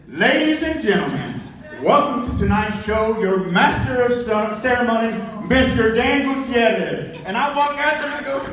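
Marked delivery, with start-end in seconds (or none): 0.1-7.2 big, loud announcer imitation; booming mock event announcer; voice of God. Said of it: "you hear the voice of God".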